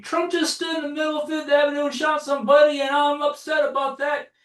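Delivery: high-pitched ranting yell; mock Alex Jones shout. Mimicking Alex Jones ranting about Trump, in a high yelled rant. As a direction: rant like an outraged conspiracy podcaster. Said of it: "Alex Jones is out there saying".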